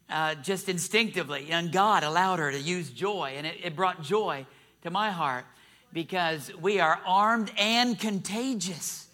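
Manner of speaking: ordinary delivery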